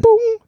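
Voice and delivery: high chime-like boom; seatbelt chime. Retold or imitated. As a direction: imitate the plane's seatbelt chime. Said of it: "I went".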